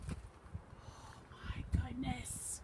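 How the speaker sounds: slow, drawn-out whisper; hushed shock. Pretend. Reader reacts to the dark woods, slow whisper. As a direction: whisper it in mock dread. Hushed gasp at scary story.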